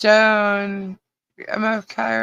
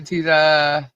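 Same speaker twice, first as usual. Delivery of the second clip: normal talking voice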